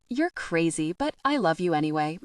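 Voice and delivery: ordinary delivery, nothing marked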